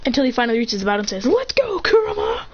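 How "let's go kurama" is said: high, eager battle call; rallying cry. Acted. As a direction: call your partner into the fight, pitched way up. Naruto calling Kurama into battle.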